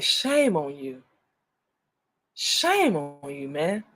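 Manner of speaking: slow, drawn-out reproach; scolding. Acted. Speaker to doubting commenters, scolding. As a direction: stretch each "Shame" out, with a long pause between.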